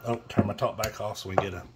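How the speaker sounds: normal talking voice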